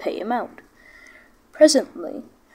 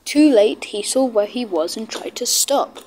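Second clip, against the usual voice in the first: ordinary delivery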